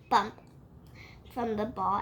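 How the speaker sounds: plain reading voice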